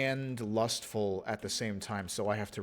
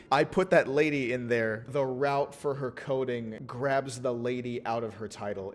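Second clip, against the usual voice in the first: ordinary talk, nothing marked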